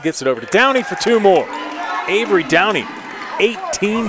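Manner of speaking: high, loud scoring call; excited. Real, not acted. Assist and score called.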